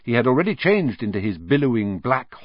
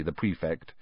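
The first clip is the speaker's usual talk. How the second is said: ordinary delivery, nothing marked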